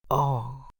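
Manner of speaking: soft, low groan as if shot to the heart; a quiet wounded moan; stricken groan. A narrator's reading. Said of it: "jęknął Athos".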